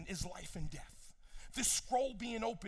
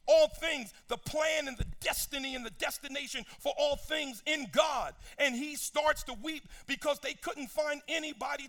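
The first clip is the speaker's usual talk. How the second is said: loud, higher preaching build to a shout; full-force preaching; 4 semitones higher, 4 dB louder than usual. Acted. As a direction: preach it out with rising fire.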